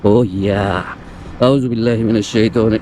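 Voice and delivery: imitating a vlogger. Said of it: "সে যেই ভয়েসগুলো উচ্চারণ করে".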